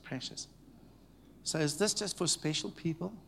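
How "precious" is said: hushed tender. Real, not acted. Quiet room, tender remark.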